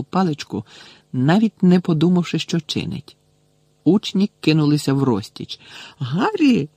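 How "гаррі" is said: high-pitched cautioning call; warning cry. A narrator's reading. Hermione warns Harry as he draws his wand, crying out. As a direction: call his name to stop him. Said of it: "застережливо крикнула Герміона".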